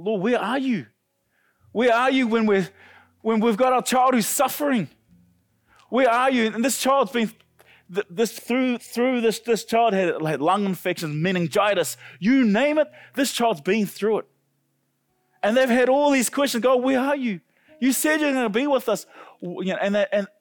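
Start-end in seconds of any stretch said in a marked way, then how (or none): 0.0-14.3 higher, louder anguished questioning; impassioned retold cry to God. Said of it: "they begin to cry out to God". 16.6-19.1 high-pitched pleading challenge to God; retold desperate appeal, pitched high; crying out to God. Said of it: "they begin to cry out to God".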